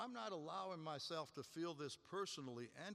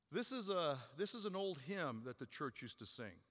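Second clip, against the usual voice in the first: ordinary delivery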